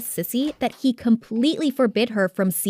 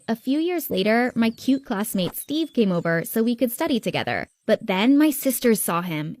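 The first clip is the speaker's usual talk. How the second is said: plain reading voice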